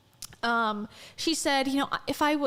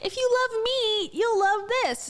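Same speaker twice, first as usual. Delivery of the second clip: mock sweet pitch; 10 semitones higher than usual. Mocking Rachel's pitch.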